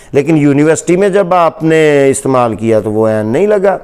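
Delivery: usual speaking voice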